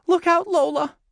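very high, loud warning; alarmed cry. A narrator's reading. Tom warning his sister of the hand, crying out. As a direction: shout a shrill-pitched kid's warning. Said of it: "he cried".

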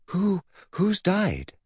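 slow, high, halting question; gasping alarm. Acted. Boy to great-uncle beside hearse, alarmed gasp. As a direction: catch the breath between stuttered words. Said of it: "he gasped".

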